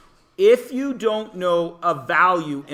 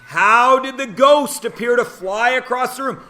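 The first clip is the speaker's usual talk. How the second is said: ordinary talk, nothing marked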